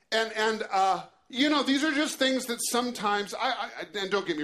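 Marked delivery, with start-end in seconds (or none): none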